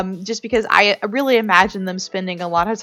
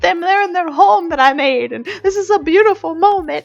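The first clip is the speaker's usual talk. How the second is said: high, gushing proud-mom squeal, half-joking; mock teary gushing; 11 semitones higher, 4 dB louder than usual. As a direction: gush over your Sims like a proud, weepy parent. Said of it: "it's like".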